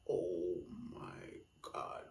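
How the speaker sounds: low, slow breathless whisper; shocked whisper. Retold or imitated. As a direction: whisper it in horror. Reacting to a crying woman.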